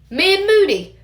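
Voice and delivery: impatient. Acted. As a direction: snap it out, impatient.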